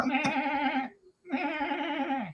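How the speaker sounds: long, wobbling imitated goat bleat. Mimicking the goat on the drive home, wobbly bleating. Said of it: "You'll hear".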